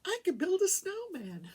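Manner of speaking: put-on high, childlike delight in the sister's voice; gleeful sister. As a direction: imitate her squealing about the snow. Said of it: "She's like".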